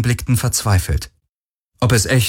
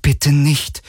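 higher, understated plea; silently begging, voiced as a small plea; pleading; 6 semitones higher than usual. A narrator's reading. Stowaway begging the captain not to tell, pleading. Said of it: "flehte Kirais Blick".

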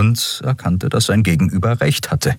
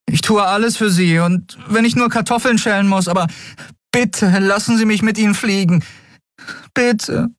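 put-on very high, imploring boy voice; high-voiced young lad begging. A narrator's reading. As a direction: plead desperately, pitched right up. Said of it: "flehte der Junge".

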